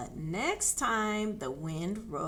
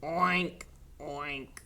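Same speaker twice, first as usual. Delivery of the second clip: sad oink; 5 semitones lower than usual. A narrator's reading. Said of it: "said Duck sadly".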